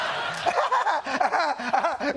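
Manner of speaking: high, loud cackle; loud cackling laugh. Retold or imitated. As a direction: cackle wildly at the joke. Mocking Trump's crude joke.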